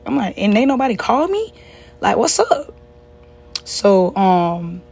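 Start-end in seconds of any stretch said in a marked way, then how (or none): 0.4-2.7 high-pitched retold disbelief; retold indignant surprise. Daughter learning nobody called her about mom, retold indignant surprise. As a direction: act out being put out, pitch raised. Retells angry surprise at aunt's call.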